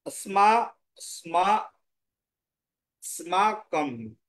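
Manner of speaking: স্মা, স্মা, স্মাকম built up slowly syllable by syllable. Shown as a demonstration. Teacher sounds out a Sanskrit word for students, slowly. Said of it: "এর উচ্চারণ তাহলে".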